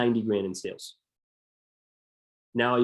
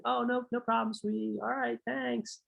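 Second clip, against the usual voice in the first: much higher, sweet and breezy customer; high cooing customer, "no problem, sweetie"; 10 semitones higher, 6 dB quieter than usual. Pretend. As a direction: play the polite customer brushing it off. Customer answering the sales rep.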